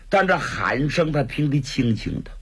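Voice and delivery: plain reading voice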